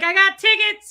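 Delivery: high, loud scalper's hawking call; ticket scalper. Retold or imitated. Dad turns around to sell his son's tickets, scalper's call. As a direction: imitate a scalper calling to the crowd. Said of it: "he becomes a scalper".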